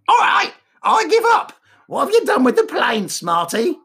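high parrot voice. Acted. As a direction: squawk it like a talking parrot. Parrot punchline.

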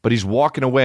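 ordinary delivery

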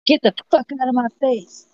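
high, hammed-up shove-off line; mock angry Joanna. Pretend friend rejecting an apology, high mock anger. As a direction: play a friend fake-furious at an apology. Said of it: "she was probably like".

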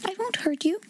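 high, soothing reassurance; sweet, gentle promise; coaxing voice. A narrator's reading. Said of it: "speaking in a coaxing voice".

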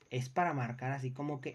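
ordinary delivery

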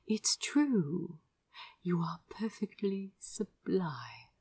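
hushed admiring whisper; adoring whisper. A narrator's reading. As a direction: whisper the compliment tenderly. Lover's whispered compliment.

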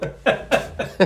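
airy high-pitched giggle; high breathy laugh. Real, not acted. Laughing at cohost's corn dog bit, wheezing.

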